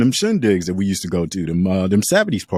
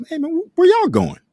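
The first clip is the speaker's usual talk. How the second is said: uncle's voice; 3 dB louder than usual. Retold or imitated. Uncle voiced in retold story.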